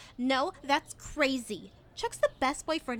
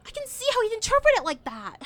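higher, louder, quicker rush of realisation; distressed realisation; 7 semitones higher, 6 dB louder, faster than usual. Acted. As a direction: blurt it out as it dawns on you. Sudden realisation about her boyfriend.

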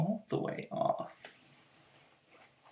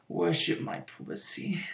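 usual speaking voice

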